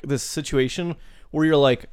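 usual speaking voice

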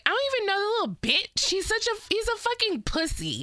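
high, fast mock Kelly rant. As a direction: toss it off like a snippy housewife. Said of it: "Kelly was like".

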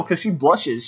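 usual speaking voice

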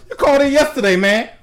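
high, pushy mock confrontation; mock accusation. Son copying mom's approach on a caller, mock accusing. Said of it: "gonna start being like".

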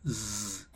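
very quiet low zzz; soft buzz. Shown as a demonstration. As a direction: buzz gently. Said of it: "es de una setita". (zumbido muy suave)

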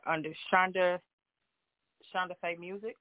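ordinary delivery

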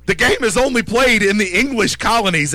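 a hammed-up huff at England's first win; mock outrage. Real, not acted. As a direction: play up the outrage over the English game. Mock indignation about cricket.

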